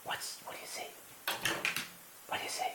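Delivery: soft unvoiced whisper; whisper to dog. Real, not acted. Asking the dog softly what she sees, whispered.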